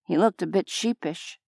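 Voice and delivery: ordinary delivery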